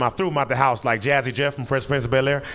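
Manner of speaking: ordinary talk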